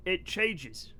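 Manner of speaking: ordinary talk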